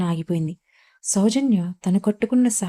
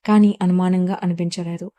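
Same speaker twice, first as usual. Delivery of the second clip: usual speaking voice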